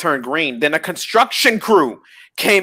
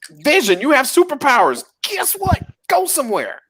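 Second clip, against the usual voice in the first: high-pitched, exasperated telling-off; mock exasperation; 11 semitones higher than usual. Viewer telling off Vision on screen, high and exasperated. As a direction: climb higher as the mock scolding builds.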